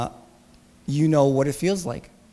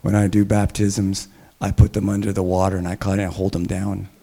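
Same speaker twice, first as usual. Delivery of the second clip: imitating a pastor's big deep voice, much lower; Chuck Smith's deep voice; 8 semitones lower than usual. Pastor retelling an older pastor's baptism joke, deep booming voice. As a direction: drop low and boom it out like a veteran preacher. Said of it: "he's got this deep, very deep, big voice".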